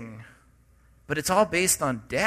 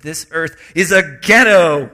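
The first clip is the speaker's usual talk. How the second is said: louder, punchy imitation of his friend; emphatic quote; 11 dB louder than usual. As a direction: hammer the line like Mark did. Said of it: "That's the way he said it".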